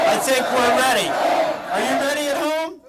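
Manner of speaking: pumped-up crowd call; rally the audience, loud; hype shout. Real, not acted. Hyping the crowd.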